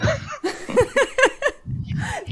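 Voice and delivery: high, giddy laughter after howling; laughing. Real, not acted. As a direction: burst into giggles. Laughing after group howl.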